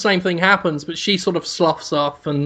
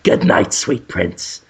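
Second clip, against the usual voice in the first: tender farewell whisper; 6 semitones lower, slower than usual. Acted. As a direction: breathe it like a grieving lover. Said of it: "kisses the leaf".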